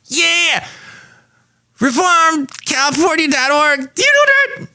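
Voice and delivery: very high cartoon shout; shrieking cartoon voice. Cartoon guest shouting the website at Mike. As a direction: yell the slogan like a cartoon.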